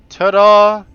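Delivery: loud, high showman's ta-da; triumphant ta-da. Real, not acted. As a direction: present it like a magic trick. Unveiling the flying ship.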